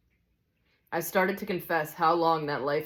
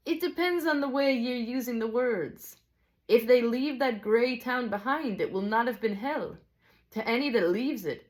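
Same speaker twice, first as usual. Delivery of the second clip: high, lilting amateur Scots; animated Scottish character voice; Scottish teacher; 7 semitones higher than usual. Acted. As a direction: explain as a storybook Scotsman, pitched well up.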